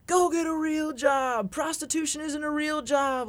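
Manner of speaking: mocking yell. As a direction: yell it like a heckler from a doorway. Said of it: "just yelling".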